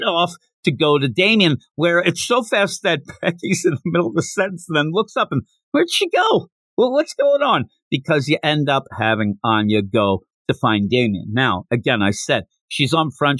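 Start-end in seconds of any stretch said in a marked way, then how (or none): 5.7-7.7 put-on much higher, puzzled little girl; confused Becky. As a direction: look around bewildered. Becky confused, Anya vanished.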